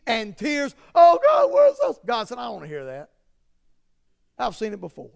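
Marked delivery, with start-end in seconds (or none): 0.9-2.0 high, louder hammed-up wail; mock wailing. Mock worshippers wailing at altar. 2.4-5.1 God tired of fake tears; weary God voice. God rejecting the crying.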